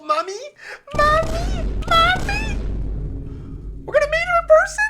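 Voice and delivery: very high, loud shrieking squeal; excited squeal. Acted. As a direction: squeal like an overexcited kid. Gamer squealing at mom character.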